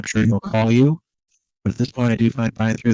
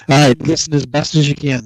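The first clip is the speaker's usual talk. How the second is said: raised, louder voice; trying to be loud; 4 semitones higher, 6 dB louder than usual. Real, not acted. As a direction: project for a hard-of-hearing man. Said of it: "I'm trying to be loud for him".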